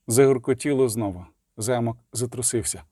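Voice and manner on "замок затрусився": ordinary delivery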